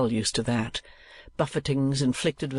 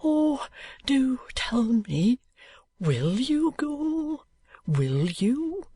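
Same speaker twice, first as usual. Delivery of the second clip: high, slow, whispered begging; a hushed, high plea, drawn out slowly; imploring whisper; 11 semitones higher, much slower than usual. A narrator's reading. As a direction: plead in a breathless hush.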